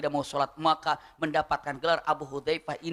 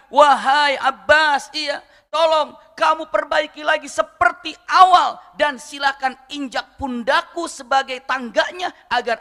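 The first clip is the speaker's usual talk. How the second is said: loud, slow call to Abbas; calling out firmly; 7 semitones higher, 8 dB louder, slower than usual. Acted. Umar calls Abbas to fix the gutter, slow and loud. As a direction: call out with weight, slowly, to Abbas.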